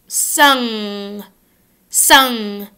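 "sung," with a long back nasal NG. Shown as a demonstration. Teacher drilling sung against sun, nasal NG. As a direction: hum "sung," through the nose. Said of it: "Now say s".

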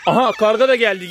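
usual speaking voice

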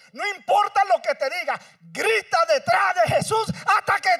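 loud, high shouted exhortation; preaching at full force. Acted. Preacher urging the congregation, loud shouted exhortation. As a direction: urge the congregation to cry out.

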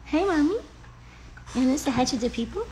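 high, soft pet-owner coo; sweet high-pitched talking-to-pets voice; dog-talk voice. Real, not acted. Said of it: "specific voices that you use with your dog".

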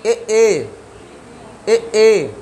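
ए, ए pressed from the front of the tongue; vowel demo. Shown as a demonstration. Teacher demonstrating ए to students. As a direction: push the tongue and sound ए, ए. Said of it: "जीभ इस तरह रखो और pressure मारो".

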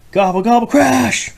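high loud gobbling then crash; turkey gobble crash. Acted. Parent acting out crashing turkeys in a picture book. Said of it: "Turkey smashed into the coop".

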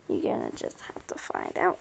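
secretive whisper; hushed teasing whisper. Real, not acted. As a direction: breathe it like a secret.

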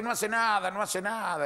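high, repeated reassurance; insistent dismissal. Acted. Old man vouching for his dog, dismissive.

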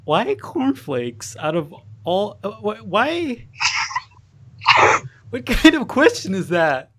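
slow, high, baffled question; puzzled disbelief, drawn out. Real, not acted.

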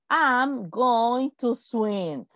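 very slow, word by word; slow model sentence. Shown as a demonstration. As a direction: say it slowly so students repeat. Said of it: "Fácil". (frase modelo muy lenta)

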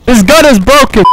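high, loud outburst at the game; frustrated yell. Real, not acted. Rages at a failing gun in game.

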